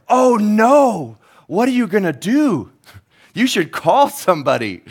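high, loud, panicky squeal; a flustered dispatcher losing it; panicked operator. Acted.

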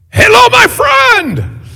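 very high, slow, loud sing-song shout; booming clerk greeting. Retold or imitated. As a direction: belt it out like an overjoyed shopkeeper. Shop clerk greets him loudly.

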